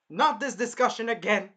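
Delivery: loud, high fed-up outburst; imitated irritated shout; pissed off. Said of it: "I would be very pissed".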